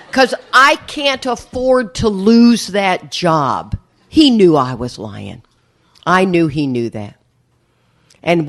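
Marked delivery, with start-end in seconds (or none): none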